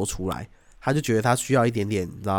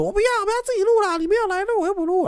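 high, fast whining, much higher; mock whiny co-host; faster than usual. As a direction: whine like the stubborn co-host. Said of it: "他都说". (模仿老司机尖声耍赖)

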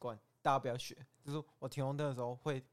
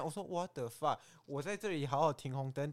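usual speaking voice

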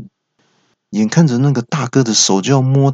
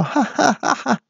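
much higher, softer hammed-up laugh; mock thug laughter; 3 dB quieter than usual. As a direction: cackle like a gang of hoodlums. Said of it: "放声大笑".

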